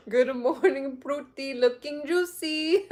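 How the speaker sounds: put-on high sing-song Indian accent, slow, with laughter; mock Indian accent. Streamer quoting the viral man's newer greeting, giggly sing-song mock Indian accent. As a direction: coo it playfully like the Indian fruit man. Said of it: "trying to sing like how he sings".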